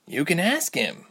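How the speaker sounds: teasing. A narrator's reading. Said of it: "Ilse teased".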